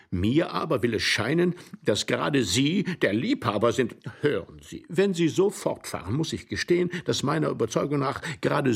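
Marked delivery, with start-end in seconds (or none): none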